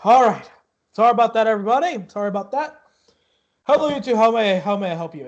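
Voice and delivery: winded ticket seller, high and loud; out of breath. Acted. Flustered half-elf greets the two arrivals, out of breath. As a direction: puff out an apologetic greeting. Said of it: "seems out of breath".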